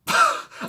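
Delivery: sudden high loud laugh; real high laugh bursting out; loud burst of laughter. Real, not acted. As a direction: burst out laughing at your own verdict. Bursts out laughing at own verdict.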